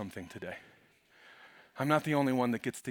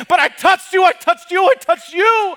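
high, loud, hammered repetition; woman crying out her testimony; shouted testimony; 18 dB louder than usual. Acted. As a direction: shout it three times. Woman's cry, repeated.

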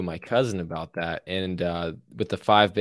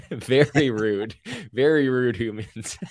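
slow, higher-pitched, laughing delivery; amused, laughing; 5 semitones higher, slower than usual. Real, not acted. Teasing friend about both being impolite, amused and laughing. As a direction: say it through laughter, drawn out. Joking about their shared rudeness.

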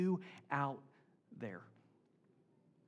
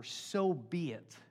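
very slow, measured; slow solemn; much slower than usual. Shown as a demonstration. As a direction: draw it out gently.

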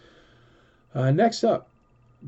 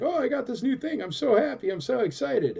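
much higher, squealing with mock delight; gushing brain meme; 13 semitones higher, 4 dB louder than usual. Brain meme gushing over its new toy, squealing excitedly. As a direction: play the giddy kid with a new toy. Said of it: "and he's like".

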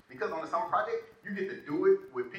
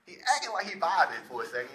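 ordinary delivery

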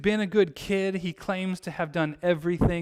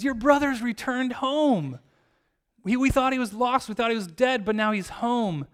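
higher, louder proclaiming of good news; acted joyful announcement; 6 semitones higher, 4 dB louder than usual. Servant telling older son his brother is home, acted joy. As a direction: announce it eagerly, pitched up.

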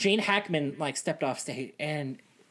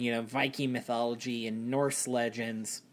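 ordinary talk

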